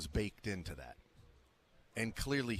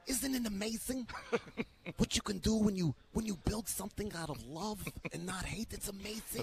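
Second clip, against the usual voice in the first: Jerome Tang impression; 10 semitones higher than usual. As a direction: lift the voice high and gentle, with a laugh. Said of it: "my impersonation of Jerome Tang".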